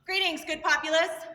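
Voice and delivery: loud court address; projected greeting. Real, not acted. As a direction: call out to the whole hall.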